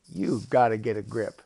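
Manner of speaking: loud, emphatic scolding; exasperated octopus. Acted. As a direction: come down hard and loud on it. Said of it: "And the octopus says".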